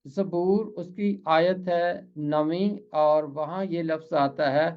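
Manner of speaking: ordinary talk, nothing marked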